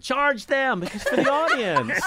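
high-pitched indignant mock shout; re-enacted yell from stage. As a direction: shout instructions across a club. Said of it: "I'm yelling from stage".

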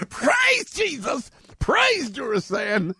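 high, drawn-out preacher's cry; hammed-up hallelujah shout, stretching each word; mock revival praise. Host mocking the Jesus joke, mock revival praise.

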